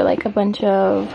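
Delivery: ordinary delivery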